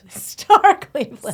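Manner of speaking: high, loud, slow showman call of the name; grand announcer. Acted. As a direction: announce it like a star taking the stage.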